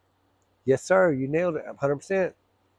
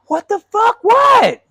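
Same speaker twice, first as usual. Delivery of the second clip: much higher, louder angry yell; furious shout; 8 dB louder, slower than usual. Retold or imitated. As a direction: blow up in disbelief. Driver exploding at the spotter.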